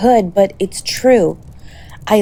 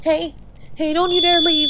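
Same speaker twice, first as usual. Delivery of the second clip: broken plea; 9 semitones higher, 3 dB quieter than usual. Acted. Pleading as partner slips.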